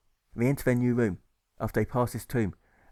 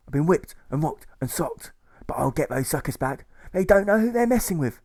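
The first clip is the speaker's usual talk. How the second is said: higher, louder threatening boast; defiant agitator; 6 semitones higher, 5 dB louder than usual. Acted. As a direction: build to a furious threat. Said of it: "the hairy agitator competes".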